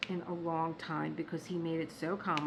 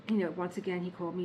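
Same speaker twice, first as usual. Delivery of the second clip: usual speaking voice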